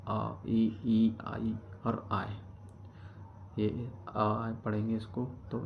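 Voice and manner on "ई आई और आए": slow, careful "ई, आई और आए"; slow vowel demo. Shown as a demonstration. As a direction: model "ई, आई और आए" slowly. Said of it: "आई के उच्चारण".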